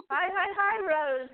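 high, giggly hellos back; match her excitement with a laugh; host laughing back at a gleeful caller, high and bright. Real, not acted.

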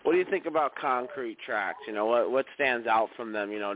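ordinary talk, nothing marked

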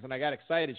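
ordinary talk, nothing marked

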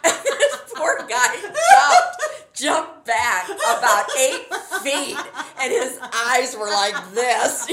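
slow, helpless laughing storytelling; laughing hard. Real, not acted. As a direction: talk through big laughter, high and loud. Cracking up at own story.

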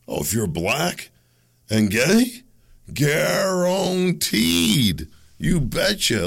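high, slow, stretched-out guaranteed; mock a gushing TV booker; drawn-out mock gush. Mocking the media, a high, slow, drawn-out gush.

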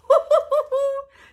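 a high, loud burst of laughter; excited laugh. Real, not acted. Preacher laughing, fired up by the story, loud high laugh.

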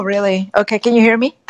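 ordinary talk

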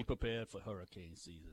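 low Cajun coach impression; Ed Orgeron. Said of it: "fake Ed Orgeron".

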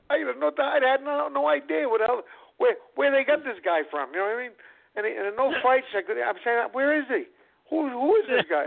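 high-pitched, amused disbelief; retold bafflement. Retells his bafflement at unknown opponent.

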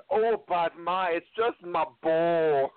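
put-on high, slow Scottish child's voice; a little boy lisping in a Brave-style Scottish accent. [name] answers his mother in a high Scottish kid voice. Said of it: "I'm really glad you did the accent".

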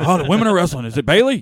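loud, high, eager question; breathless-with-excitement mock kid; excited kid imitation. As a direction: imitate a thrilled child hearing the news. Acting out excited daughter's reply.

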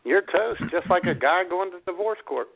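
ordinary delivery

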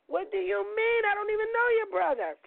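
high, stunned squeal of disbelief; recoil, baffled and high; shocked disbelief. Retold or imitated. Shocked reply to accusation.

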